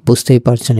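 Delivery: ordinary delivery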